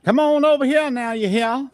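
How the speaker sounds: imitating grandma's high Southern "you hear?"; Southern grandmother impression. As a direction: call the kids over like a sweet Georgia grandma. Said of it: "she used to talk like that all the time".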